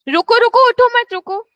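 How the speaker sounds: calling out to stop. Real, not acted. Teacher stopping students getting up to leave, loud urgent call. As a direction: call sharply over the class to halt them.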